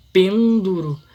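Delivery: "pêndulo" with PEN loud and stretched; stress on PEN. Shown as a demonstration. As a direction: punch the first syllable of "pêndulo", let the rest fall. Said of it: "sílaba mais forte?". ("pêndulo" com ênfase forte no PEN)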